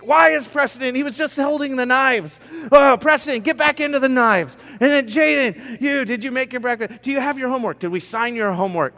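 dad juggling chaos all at once; panicked dad. Acted. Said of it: "so then it's like".

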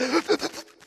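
high, stuttering mock sob gasps; hup hup cry. Shown as a demonstration. Man demonstrating his uncontrollable cry, exaggerated hitching gasps. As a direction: imitate convulsive crying gasps. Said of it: "I'm almost at the hup hup cry".

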